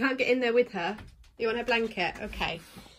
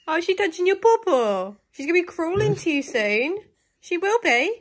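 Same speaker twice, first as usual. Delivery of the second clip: excited cooing; 7 semitones higher, 5 dB louder than usual. Real, not acted. Cooing over baby and dog.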